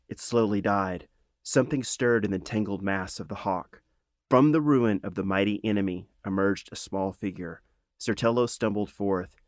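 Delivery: ordinary delivery, nothing marked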